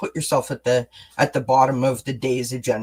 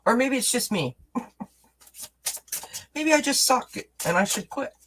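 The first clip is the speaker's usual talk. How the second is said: mock self-pity; 9 semitones higher, 3 dB quieter than usual. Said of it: "I didn't really mean that".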